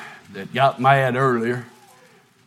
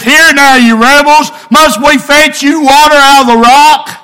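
raised, higher and louder, scolding the rebels; retold angry rebuke; 11 semitones higher, 13 dB louder than usual. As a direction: Moses snapping at the grumbling crowd. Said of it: "it shows he was angry".

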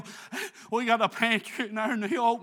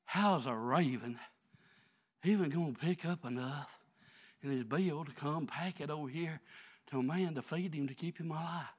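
quiet musing; 9 semitones lower, 7 dB quieter than usual. Acted. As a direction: drop to a near hush and ponder aloud. Quiet reflective musing after preaching.

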